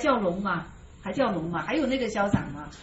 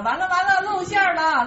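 mock alarm; 6 semitones higher, 5 dB louder than usual. Mock alarm at being exposed.

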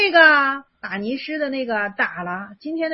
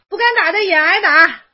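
playful loud jab; 7 semitones higher, 10 dB louder than usual. Real, not acted. Joking with student about beating.

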